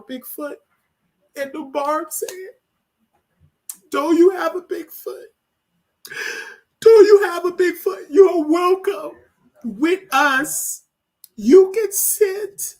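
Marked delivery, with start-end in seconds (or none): 3.9-5.3 high, tearful retelling of the question; higher, choked-up quote; teary quote. Real, not acted. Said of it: "I was not planning on crying". 6.8-8.1 high, loud crying cry of the question; real sobbing, louder and higher; sobbing shout. Real, not acted. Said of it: "I was not planning on crying". 8.1-12.7 high, slow, tearful words; real crying, higher and slow. Real, not acted. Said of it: "I was not planning on crying".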